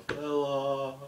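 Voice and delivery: high, loud mock brogue on fella; mock Sheamus shout. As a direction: ham up the brawler's catchphrase. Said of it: "pound his chest or".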